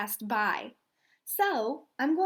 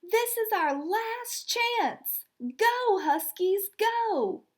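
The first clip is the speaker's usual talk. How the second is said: much higher, urgent yell; rally the team for one last push; 8 semitones higher, 3 dB louder, slower than usual. Acted. Rebecca urging her team in the last period, a high urgent yell. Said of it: "Rebecca yelled".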